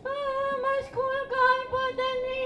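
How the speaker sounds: put-on high, slow, wailing child; crying child. Little boy crying as his school burns, high slow wail. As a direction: wail it like a small boy sobbing. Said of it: "అని ఏడుస్తుంటే".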